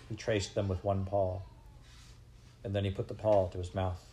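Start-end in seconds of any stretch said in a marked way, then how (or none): none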